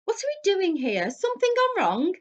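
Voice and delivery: high, loud puzzled question; boy arriving lost and unsure; confused Ron. A narrator's reading. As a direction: Ron asking what went wrong.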